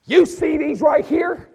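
slow, very high, loud challenge; raging sergeant. Retold or imitated. Sergeant showing his E six stripes, loud and enraged. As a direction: shove the stripes in his face. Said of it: "he's like".

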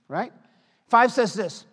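ordinary delivery

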